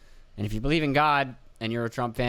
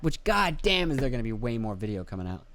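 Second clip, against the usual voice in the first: usual speaking voice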